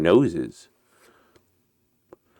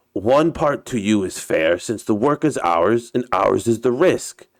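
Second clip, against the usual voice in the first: put-on Russian captain's accented English; story character with a put-on Russian accent; 4 semitones higher than usual. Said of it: "uh, my Russian accent".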